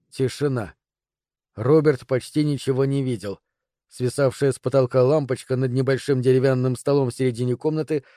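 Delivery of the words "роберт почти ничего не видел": plain reading voice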